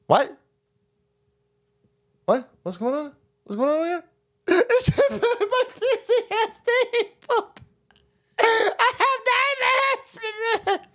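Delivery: put-on high, slow, wailing baby voice; mock sobbing crybaby. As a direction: blubber like a big baby. Mocking a crying Elmo fan.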